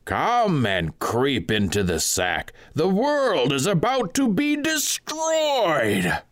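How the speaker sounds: high, slow, ringing cry from the pulpit; high, slow doomsday proclamation to the sinners; piercing preacher's cry. A narrator's reading. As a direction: call out like a doom-preaching monk, drawn out and high. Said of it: "He cried with a loud and piercing voice".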